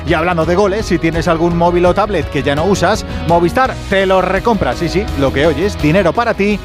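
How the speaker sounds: upbeat radio ad read. Acted. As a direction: sell it cheerfully over music. Live ad read.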